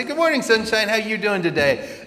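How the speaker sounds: high, loud, sing-song hello; bright cheery greeting. Retold or imitated. To the grumpy classmate, a loud high cheery greeting. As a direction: greet her like sunshine itself.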